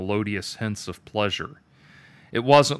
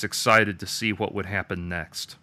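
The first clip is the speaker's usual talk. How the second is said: usual speaking voice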